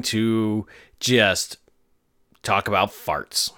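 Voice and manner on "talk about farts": normal talking voice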